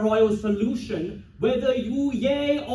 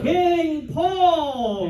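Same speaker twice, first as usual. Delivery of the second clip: higher, drawn-out proclamation; grand herald's call; ceremonial hail; 4 semitones higher than usual. Acted. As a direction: call out the king's title for the crowd. Leads crowd in a chant.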